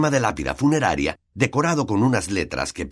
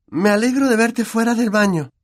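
high, louder, forced brightness; faked cheer; 14 semitones higher, 4 dB louder than usual. A narrator's reading. Hermione greeting Myrtle with faked cheer. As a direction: pretend to be happy to see her. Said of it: "fingiendo un tono animado".

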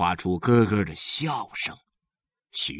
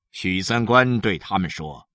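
ordinary delivery

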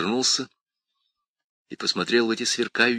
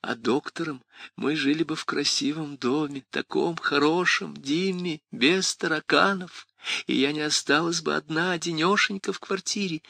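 pleading mother voice; 7 semitones higher than usual. Acted. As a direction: beg sweetly like an old mother. Demon mimics the mother's pleading.

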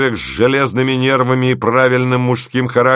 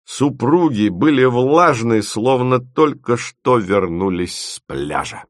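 usual speaking voice

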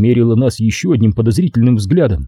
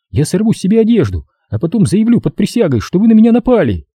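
rattling off; 10 semitones higher, faster than usual. A narrator's reading. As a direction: gabble the threat quickly. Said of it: "затараторила девица".

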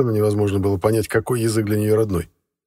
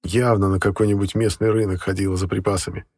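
ordinary delivery, nothing marked

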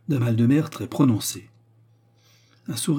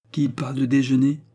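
higher, louder cold challenge; coldly; 5 semitones higher, 5 dB louder than usual. A narrator's reading. James answers George's call to lunch, coldly. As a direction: ask it with cool disdain. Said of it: "dit froidement James".

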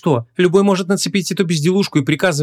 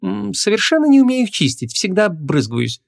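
confiding; 9 semitones higher than usual. A narrator's reading. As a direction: explain it confidentially, like a small confession. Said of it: "доверительным тоном пояснила она". (доверительный женский голос, повыше)

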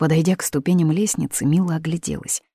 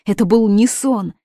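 higher, louder repeat of был; insisting on был, louder and higher; louder insistence; 5 semitones higher, 6 dB louder than usual. Acted. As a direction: push it harder.

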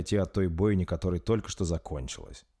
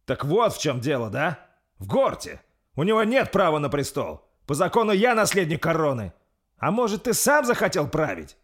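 raised voice, rising in pitch; heated retort; 9 semitones higher, 6 dB louder than usual. Acted. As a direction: jump up and counter-accuse. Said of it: "Вскочил с трона Рольф в ответ". (вспыхнув, с вызовом)

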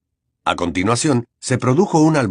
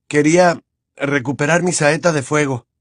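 hoarse voice; 5 semitones higher than usual. Acted. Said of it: "repuso Harry con voz ronca".

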